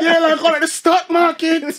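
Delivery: high, loud laughing jeer; repeated jeer. Retold or imitated. Repeating the barber's jibe to friends, high and loud. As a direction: repeat the punchline loudly. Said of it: "He said".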